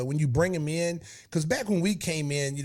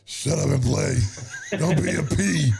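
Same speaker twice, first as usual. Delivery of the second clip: gruff coach; 4 dB louder than usual. Retold or imitated. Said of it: "And he's like".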